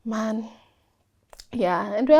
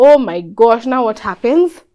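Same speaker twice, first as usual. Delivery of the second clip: panicked; 10 dB louder than usual. Retold or imitated. Panicked reaction to rejection.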